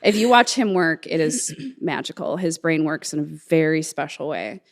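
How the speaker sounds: ordinary talk, nothing marked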